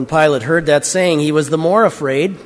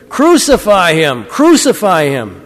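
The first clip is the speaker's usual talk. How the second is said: loud, drawn-out cry of a mob; narrator's raised, insistent chant; crowd's demand; 3 semitones higher, 5 dB louder than usual. A narrator's reading. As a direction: call it out slowly and forcefully, as a crowd would. Crowd demands an execution from Pilate.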